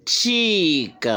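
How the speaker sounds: CHEEK high, with a drawn-out ee; long vowel. Shown as a demonstration. Teacher explaining double E, CHEEK with long high vowel. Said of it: "c'est une voyelle longue".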